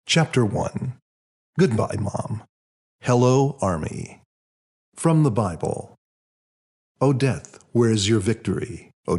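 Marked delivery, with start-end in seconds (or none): none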